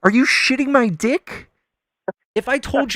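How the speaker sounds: heated, emphatic protest; incredulous outburst. Real, not acted. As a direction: fire back in exasperated disbelief. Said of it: "the way you said it".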